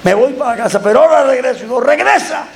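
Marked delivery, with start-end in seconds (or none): 0.0-1.6 higher-pitched mock threat; retold boyish threat. Acts the beaten boy's threat. 1.8-2.5 high-pitched mock challenge; retold dare. Acts himself daring the boy back.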